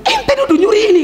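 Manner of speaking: high, laughing disbelief; shocked passerby. Retold or imitated. Passerby hearing whom he accused, high laughing disbelief. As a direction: scoff in disbelief.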